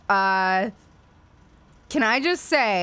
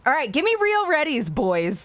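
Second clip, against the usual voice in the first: bright, fast call to chat; rally the viewers; 6 semitones higher than usual. Real, not acted. Streamer calling to her chat for readies, upbeat.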